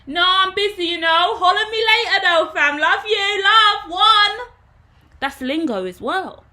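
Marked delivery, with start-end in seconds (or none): 0.1-4.5 very high, loud London roadman greeting; loud high London street-slang greeting to old friends. Shown as a demonstration. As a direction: hail a mate from the ends in London slang. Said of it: "in the ends that I grew up in".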